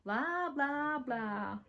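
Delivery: high singsong, drawn-out jeer; mock the tattletale, sliding down at the end. Younger sister mocks tattling threat.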